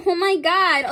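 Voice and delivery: a sudden loud, high oh my God; shocked gasp. Real, not acted. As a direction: blurt it out, stunned by the card. Shock at the card drawn.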